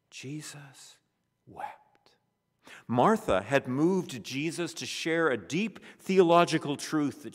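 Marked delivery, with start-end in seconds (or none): 0.1-2.2 almost whispered, breathless and slow. Shown as a demonstration. Preacher repeats the verse as a whisper. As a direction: breathe the words out. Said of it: "repeated as a whisper".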